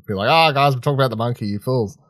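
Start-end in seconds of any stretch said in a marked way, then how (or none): none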